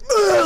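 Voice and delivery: loud, high over-the-top "ugh"; over-the-top mock yell; self-parody growling yell. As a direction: belt out a hammed-up noise as your own stage persona. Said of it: "like, full [name]".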